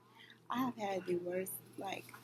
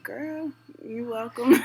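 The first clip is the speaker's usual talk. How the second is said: higher, sassy retort; sassy comeback; 4 semitones higher, 11 dB louder, much slower than usual. Retold or imitated. Worker answering her scheduling manager with sass. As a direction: toss it back with attitude. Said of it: "And I was like".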